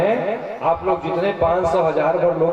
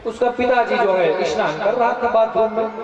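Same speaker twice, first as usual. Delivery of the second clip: normal talking voice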